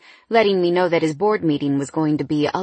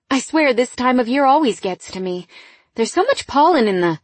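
higher, louder, hurried excuse; flustered; 7 semitones higher, 5 dB louder than usual. Acted. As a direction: cover the tears brightly. Woman covering tears, flustered.

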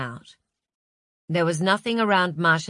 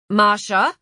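loud, raised, questioning call of a name; loud, commanding; 4 semitones higher, 8 dB louder than usual. A narrator's reading. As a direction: call out sharply to check for a response. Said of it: "spoke in a loud, commanding voice".

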